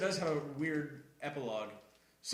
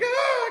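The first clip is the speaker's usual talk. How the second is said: startled yelp; 9 dB louder than usual. Retold or imitated. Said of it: "they're like".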